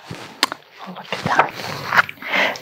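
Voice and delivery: slow whisper; whispered aside. Real, not acted. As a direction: hush the listeners.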